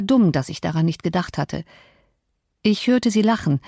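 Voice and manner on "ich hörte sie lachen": ordinary delivery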